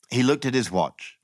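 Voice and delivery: usual speaking voice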